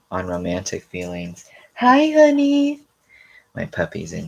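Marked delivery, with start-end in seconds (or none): 1.7-2.8 talking to a puppy. Real, not acted. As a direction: coo it up high to the dog. Greets her puppy.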